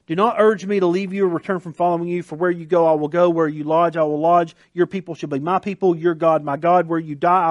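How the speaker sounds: rattling the verse off quickly; reading fast. Shown as a demonstration. Preacher reading Ruth's vow aloud, quickly. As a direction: race through the scripture. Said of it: "I realize I'm reading that fast".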